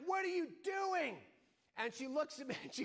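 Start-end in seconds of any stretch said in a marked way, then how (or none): 0.1-1.2 high exasperated yell; angry and baffled at once; half question, half anger. Retold or imitated. Husband yelling at his motionless wife, half angry. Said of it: "half question, half anger".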